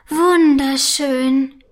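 slow, drawn-out wonder; amazed. A narrator's reading. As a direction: marvel at it, stretching the word. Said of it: "staunt sie". (staunend, gedehnt)

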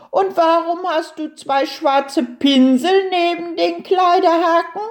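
high-pitched questioning turtle voice; curious little turtle. A narrator's reading. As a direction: ask up high, full of curiosity.

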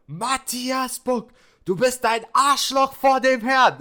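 high, slow, loud mock scream, cracking into a laugh; retold mom's shriek, barely holding back laughter; mother screaming. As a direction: shriek the full name like an outraged mom. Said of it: "dass sie so geschrien hat".

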